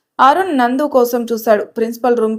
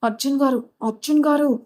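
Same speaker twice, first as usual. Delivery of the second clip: a touch of frightened whisper-calling; fearful; 3 semitones higher, 4 dB quieter than usual. Acted. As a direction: urgently wake him without being heard. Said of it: "Valli భయంగా అర్జున్ వైపు చూసి".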